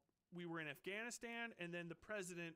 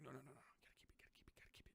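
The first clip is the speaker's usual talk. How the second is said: whispered, clutching the money; mock greedy whisper; 3 semitones lower, 14 dB quieter, much faster than usual. Host mocking a hoarding government in a greedy whisper. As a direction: hiss it like a miser hiding coins.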